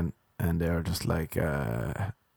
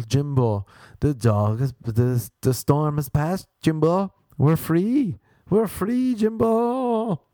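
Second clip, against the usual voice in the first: melodramatic relief, climbing higher to a stretched high cry; hammed-up commercial acting; 7 semitones higher, slower than usual. Actor tells his pretend dog the storm has passed, hammed-up relief. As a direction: overact a triumphant, drawn-out announcement of freedom. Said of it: "I had to pretend".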